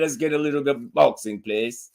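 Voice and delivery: lightly put-on English accent, softening at the end; mock-English delivery. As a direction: slip into a playful English voice. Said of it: "In my English voice".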